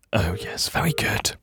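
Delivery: whispering. Acted. Whispered grudging reply.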